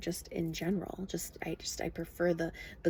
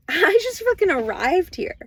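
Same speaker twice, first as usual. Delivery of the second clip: much higher, louder laughing outrage; incredulous outburst; 11 semitones higher, 15 dB louder than usual. Real, not acted. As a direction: blurt it in disbelief. Outraged at paying to live.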